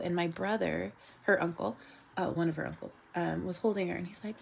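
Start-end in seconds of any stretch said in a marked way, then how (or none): none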